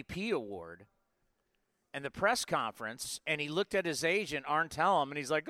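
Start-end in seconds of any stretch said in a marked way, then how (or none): none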